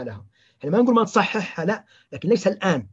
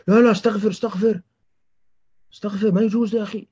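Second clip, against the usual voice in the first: slightly raised, hasty admonishing; mild acted rebuke; 3 semitones higher than usual. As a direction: cut the patient off with a mild mock scolding. المرشد يزجر المريض مستعجلًا. (زجر خفيف متعجّل)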